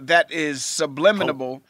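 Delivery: "subliminal" said as sub-LIM-in-uh-bul; extra syllable. Real, not acted. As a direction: add an extra "-able" ending to "subliminal". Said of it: "extra syllable there".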